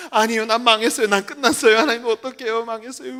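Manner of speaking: whining lament that trails away; mock wailing despair. Pastor next day back in despair, mock wailing. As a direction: wail it out, then fade as if sobbing. Acted relapse into wailing prayer.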